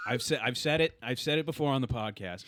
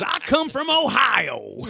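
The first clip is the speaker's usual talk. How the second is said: shouted, high, drawn-out southern drawl; southern accent; 12 semitones higher, 10 dB louder, much slower than usual. Retold or imitated. Comic dumbing it down for the crowd, shouted southern accent. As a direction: holler it in a mock southern accent. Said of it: "He went back into his southern accent".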